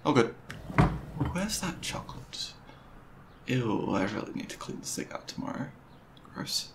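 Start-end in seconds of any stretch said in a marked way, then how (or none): 1.3-6.7 soft half-voiced fridge muttering; quiet to himself. Real, not acted. Rummaging in the fridge alone, softly muttering to himself.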